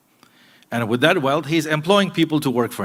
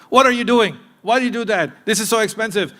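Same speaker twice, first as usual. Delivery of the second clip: mock angry parent; 7 semitones higher than usual. Preacher imitating a parent scolding a child, mock angry. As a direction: play the snapping parent over a broken cup. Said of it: "Anger and controlling is the first reaction".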